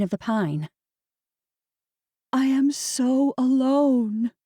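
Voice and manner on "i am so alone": high, slow, tearful. A narrator's reading. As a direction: say it slowly through tears. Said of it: "Tears stung her eyes".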